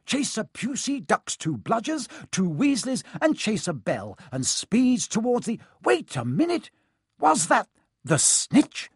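high-pitched match commentary; Lee Jordan. A narrator's reading. Commentator spotting the snitch mid-play, high excited commentary. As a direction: call the play, breaking off in amazement. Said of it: "Lee Jordan was saying".

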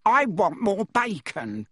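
slow, high, whiny demand; spoilt boy whine. Acted. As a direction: drag out the demand like a spoilt child.

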